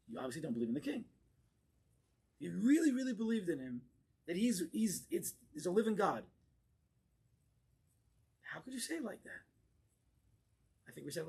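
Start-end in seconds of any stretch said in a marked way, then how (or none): none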